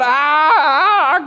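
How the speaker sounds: long, loud, high scream; imitated terrified yelling, drawn out; mock scream of fear. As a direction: scream like terrified men, hammed up. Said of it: "empezaron a gritar ... con el miedo".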